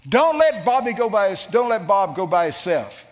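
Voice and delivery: high, loud insistent command; urgent warning. Retold or imitated. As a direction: press it on her urgently. God warning the wife.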